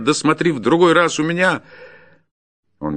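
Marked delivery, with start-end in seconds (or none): 0.0-1.6 high, loud parting warning; shouted threat. Acted. Forester warns the departing peasant, shouting. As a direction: yell the warning after him. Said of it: "закричал ему вслед".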